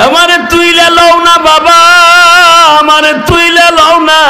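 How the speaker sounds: chanted lament of a frightened daughter; child's desperate calling. Acted. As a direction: cry up from the pit, stretching each plea. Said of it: "এইভাবে ডাক দিয়েছে".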